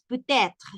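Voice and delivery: peut-être with the T linked into être, stressed; liaison in peut-être. Shown as a demonstration. Teacher drilling peut-être for Spanish speakers. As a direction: run peut-être together, T carried over. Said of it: "y lo pronunciamos".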